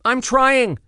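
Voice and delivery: high, loud strained call; called back. Acted. Bernie calling back to Evol while diving. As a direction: call back mid-dive. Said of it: "Bernie called back".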